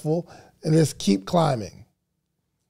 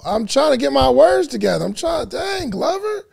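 mock protest; 8 semitones higher than usual. Real, not acted. Defending himself to a commenter.

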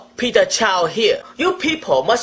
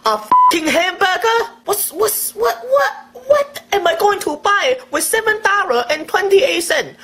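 outraged rant; 5 semitones higher than usual. Acted. Outraged over tiny payout.

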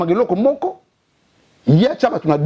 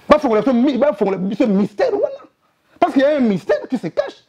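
heated demand; 6 semitones higher than usual. Real, not acted.

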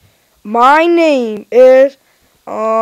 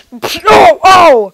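louder, higher wail; crying out; 4 semitones higher, 7 dB louder than usual. Pretend. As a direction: wail in dismay. Rejected dancer cries out.

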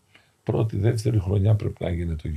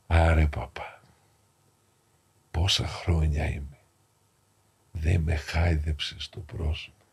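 quiet, lower, fading words of a dying man; weak dying voice; 4 semitones lower, 4 dB quieter than usual. Retold or imitated. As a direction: speak softly as a man at the end of his life. Dying man thanks priest for caress.

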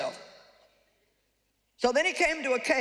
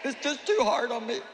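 whiny complaining to God. Retold or imitated. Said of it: "you know how it sounds".

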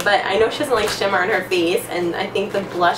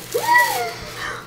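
a very high, sharp gasp; shrieking gasp. Real, not acted. Gasping at the pink blush gift.

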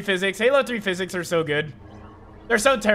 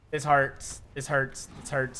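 fading mock lament; 8 semitones lower, 9 dB quieter, slower than usual.

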